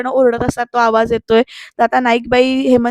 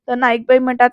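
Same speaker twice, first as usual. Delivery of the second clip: usual speaking voice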